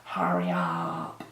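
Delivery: very low, quiet, dragged-out creak; impatient waiting. Acted. Acting out waiting hours for lash glue, weary drone. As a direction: groan it out slowly, as if waiting forever. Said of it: "you don't have to sit there with it for, like, twenty hours going".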